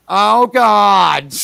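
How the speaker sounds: put-on brassy New York accent like Ethel. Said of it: "The best New York accent in that show was Ethel".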